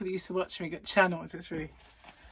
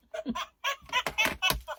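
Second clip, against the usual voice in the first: high, squealing laugh; high cackle. Real, not acted. Laugh after own joke.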